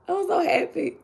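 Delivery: drained whisper-soft happiness; quiet after tears. Real, not acted. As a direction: say it softly after crying.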